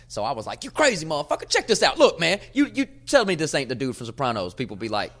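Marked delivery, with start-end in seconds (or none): 0.6-4.5 high, loud belligerent insistence; aggressive, loud. Retold or imitated. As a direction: shout them down like you can't be wrong. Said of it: "the most aggressiveness and loudness".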